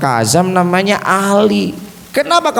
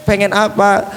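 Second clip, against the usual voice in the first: usual speaking voice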